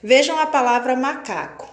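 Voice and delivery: ordinary talk, nothing marked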